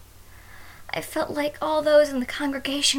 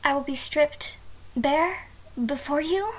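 normal talking voice

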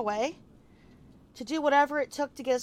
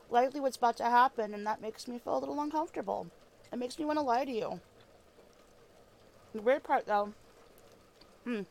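ordinary delivery

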